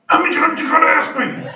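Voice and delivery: high, loud impatient yell; chewing out. Retold or imitated. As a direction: snap it like an impatient devil. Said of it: "you get chewed out".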